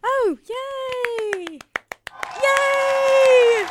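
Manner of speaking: high, loud squealing yay; excited cheer. Real, not acted. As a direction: cheer with delight. Celebrating friend's A.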